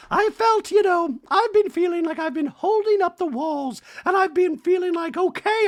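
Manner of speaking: hammed-up Dorit venting; mock Dorit. Host voicing Dorit pouring out her troubles, high. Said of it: "She's like".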